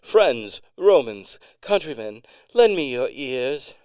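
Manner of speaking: booming Shakespearean address; theatrical greeting. A narrator's reading. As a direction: proclaim it like Mark Antony on stage. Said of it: "her customary greeting for new customers".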